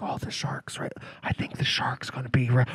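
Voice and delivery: imitated audience whisper, voiceless and hushed. Imitating audience members spotting the shark, whispering. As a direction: whisper it like a moviegoer mid-film. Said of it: "you'd hear whispering".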